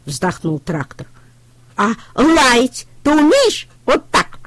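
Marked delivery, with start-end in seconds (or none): 1.8-4.4 high, louder, slow puppy question. A narrator's reading. Щенок учит трактора лаять, высоко и громко. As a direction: show him how to bark. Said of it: "спросил щенок".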